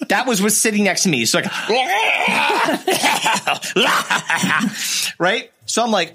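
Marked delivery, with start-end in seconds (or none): 1.5-5.1 high, shouting laugh; howling laugh. Real, not acted. Laughing at the monster image.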